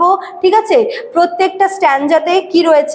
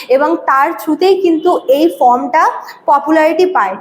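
ordinary delivery

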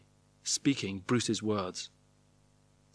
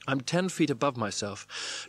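usual speaking voice

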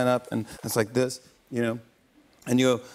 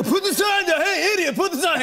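high, laughing yell at an idiot; hammed-up laughing scream; mock screaming; 3 dB louder than usual. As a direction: scream it like an angry crowd member. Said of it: "They were screaming at me".

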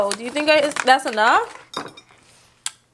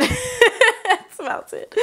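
laughing; 12 semitones higher than usual. Real, not acted. Chocolate finally melted.

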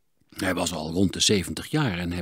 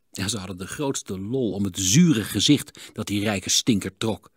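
ordinary delivery

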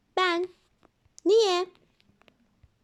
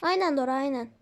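ordinary delivery

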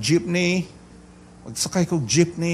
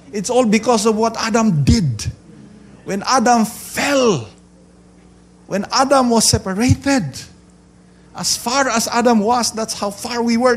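high, raised preacher's voice; forceful preaching; 8 semitones higher, 5 dB louder than usual. Real, not acted. Preacher explains Adam's fall to congregation, forceful preaching. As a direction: drive each point home to the crowd.

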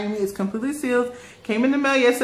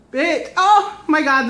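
loud, higher delighted exclamation; thrilled; 6 semitones higher, 5 dB louder than usual. Real, not acted. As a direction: burst out in delight. Thrilled trying on coat.

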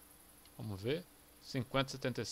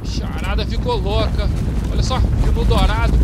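loud, thrilled shouting at the herd; excited; 5 semitones higher, 13 dB louder than usual. Real, not acted. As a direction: yell with real hunting excitement. Stampede of buffalo, hunting excitement.

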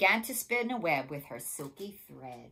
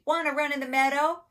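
high, loud, quick invitation; storybook sheep; 10 semitones higher, 13 dB louder, faster than usual. A narrator's reading. Sheep asking spider to run in the meadow, high and quick. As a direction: lift it high and rattle it off. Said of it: "bleated the sheep".

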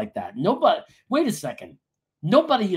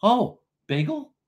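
higher, slow, questioning coo; mock distracted delight; 7 semitones higher, 3 dB louder, slower than usual. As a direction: get lured by food, pitched up and drawn out. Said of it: "I'd be like".

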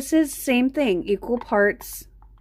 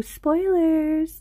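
higher, sing-song teasing Spoilers; a light, playful take on a catchphrase; Doctor Who quote; 7 semitones higher than usual. Retold or imitated. Quoting a Doctor Who catchphrase to chat, playfully. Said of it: "It's like the girl on, on, uh, Doctor Who".

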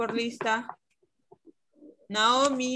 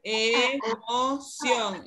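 drawn-out word; much slower than usual. Shown as a demonstration. Teacher models syllables slowly.

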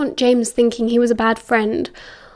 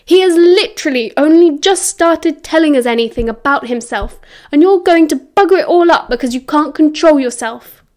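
loud, raised scolding; angry lecture; 4 semitones higher, 5 dB louder than usual. A narrator's reading. James berating Sirius for risking their friendship, heated. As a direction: berate him, pushing the voice up.

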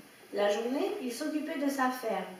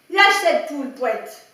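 yelling; 11 dB louder than usual. A narrator's reading. Farmer yelling at the dog holding a hen, loud yell. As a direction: bellow at the dog to drop the hen. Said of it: "hurla-t-il".

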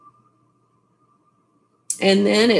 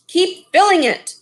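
high, louder bossy order; commanding; 8 semitones higher, 3 dB louder than usual. Acted. Missus Lee ordering servants to keep filling the wok, commanding. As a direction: demand more like a greedy mistress. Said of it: "commanded Missus Lee".

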